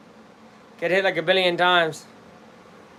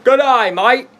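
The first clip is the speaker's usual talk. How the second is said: mock Aussie greeting, raised and loud; Australian accent; 3 semitones higher, 5 dB louder, slower than usual. Shown as a demonstration. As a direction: put on a broad Australian accent. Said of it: "without sounding Australian".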